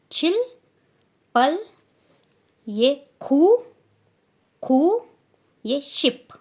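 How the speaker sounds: called out high and loud, one number at a time; teacher demo count; careful Korean counting. Shown as a demonstration. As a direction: model each number for the class. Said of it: "pronunciation मैं कैसे कर रही हूँ".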